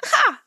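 short, high mock laugh "ha"; one squawked bird laugh; bird's throaty laugh. A narrator's reading. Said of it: "made a laughing sound in its throat".

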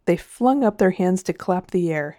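plain reading voice

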